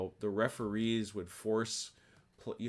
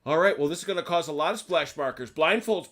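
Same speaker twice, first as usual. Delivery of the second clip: calling out; 8 semitones higher, 8 dB louder than usual. Real, not acted. Game master calling blindfolds on.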